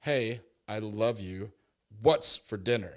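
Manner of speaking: ominous, pointed tone; accusing text reading. Shown as a demonstration. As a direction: read it like she's mad at you. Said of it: "where's the inflection?".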